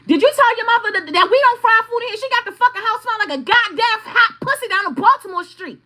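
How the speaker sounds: high, loud, fast tirade; angry rant. Retold or imitated. Calling the husband about his mother, fast loud angry rant. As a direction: let the husband have it.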